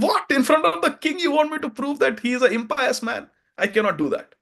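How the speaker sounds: high, stunned protest, voice pitched well up; outraged disbelief. Acted. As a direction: recoil in shock at the accusation. Kolahal outraged before the king.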